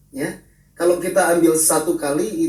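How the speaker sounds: ordinary delivery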